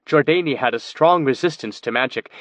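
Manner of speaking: ordinary delivery, nothing marked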